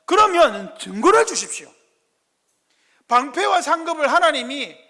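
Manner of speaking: Abraham pressing God for proof; loud, high earnest plea; pleading Abraham. Acted. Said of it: "무엇을 말하는 겁니까?".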